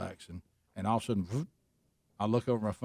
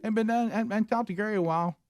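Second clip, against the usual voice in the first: mocking imitation; 6 semitones higher than usual. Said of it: "You, you said".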